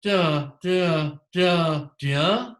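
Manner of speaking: separate, punched "da" beats, the last one swinging upward; demonstrating a tune on "da" syllables, rising at the end; Greek intonation pattern. Shown as a demonstration. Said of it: "normal Greek intonation pattern is".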